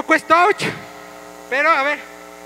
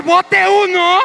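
calling out scores; 6 semitones higher, much slower than usual. Real, not acted. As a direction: shout the score to the room. Anuncia puntos al grupo. (anunciando los puntos en voz alta y aguda)

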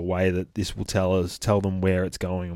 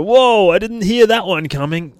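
higher and louder, mocking the unheard chopper; a loud, high send-up of surprise; sarcastic mock surprise; 11 semitones higher, 9 dB louder than usual. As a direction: ham up the fake shock.

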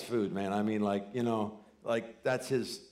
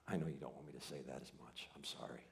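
sheepish apology; 8 semitones lower, 17 dB quieter than usual. Retold or imitated. Father's sheepish apology to daughter.

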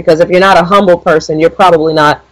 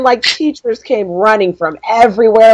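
usual speaking voice

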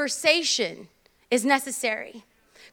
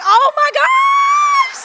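long, very high hammed-up scream; over-the-top loud gasp of drama; shocked shriek; 7 dB louder, much slower than usual. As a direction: stretch it into a mock-horrified squeal. Acted shocked novela viewer.

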